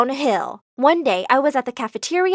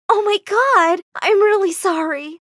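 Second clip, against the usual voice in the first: high, dismayed apology; stricken, pitched-up regret; shocked apology; 7 semitones higher than usual. A narrator's reading. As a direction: apologize as it sinks in. Shocked apology after seeing proof.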